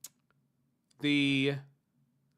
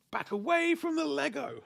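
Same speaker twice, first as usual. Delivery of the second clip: much higher, laughing mock warning; slightly scary rendition. Teasing a chatter about the girlfriend stopping a Lego splurge, high mock scold. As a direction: squeak it out like a stern playful scolding. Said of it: "that would be a slightly scary, uh, rendition".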